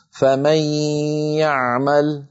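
فمن يعمل with a long nasal hum into the y; partial idgham. Shown as a demonstration. Teacher reads the last board example, partial idgham. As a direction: draw out the ghunnah joining فمن to يعمل. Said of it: "دليل على بقاء الغنة".

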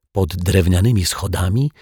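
ordinary delivery

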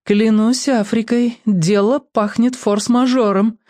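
concerned African character voice, slow; worried voice. A narrator's reading. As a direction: say it with a worried frown. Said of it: "озабоченно сказал Бамбалу". (озабоченно, голосом Бамбалу)

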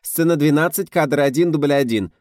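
loud, high, quick slate call; brisk rattling off. A narrator's reading. Script girl announcing the slate to the crew, brisk loud call. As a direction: rattle off the take number briskly. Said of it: "деловито зачастила".

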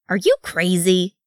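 mildly incredulous challenge; a touch of disbelief; incredulous demand. A narrator's reading. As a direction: demand it as if appalled. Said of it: "Wee Thorin demanded".

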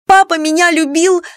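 high, loud cry of defiance; stamping protest. A narrator's reading. Daughter insisting her father loved her, defiant shout. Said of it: "топнула ногой Клара".

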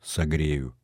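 soft, low, affectionate promise; quiet, tender reassurance. A narrator's reading. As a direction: say it gently, almost under your voice. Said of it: "сказал ласково".

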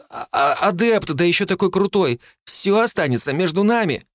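high, quick, wheedling offer; cheeky lad bargaining to save himself; scheming boy. A narrator's reading. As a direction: sell the deal eagerly. Phil proposes a deal.

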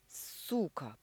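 hissed insult. A narrator's reading. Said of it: "прошипел Мануков".

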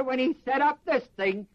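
ordinary delivery

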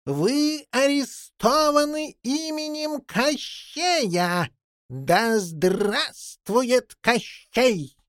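high, hugely drawn-out official cry; loud villain's proclamation. A narrator's reading. Henchman arresting boy and jackdaw from the street, proclaiming. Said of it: "раздался на улице громкий голос".

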